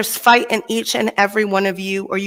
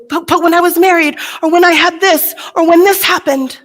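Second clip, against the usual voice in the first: whiny clinging to the past; 8 semitones higher, 4 dB louder than usual. Retold or imitated. Mocking people stuck in the past.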